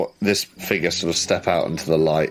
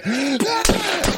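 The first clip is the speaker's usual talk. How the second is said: short high-pitched acted scream; high mock cry of alarm; startled cry. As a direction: yelp as the shot goes wild. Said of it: "turn around and go".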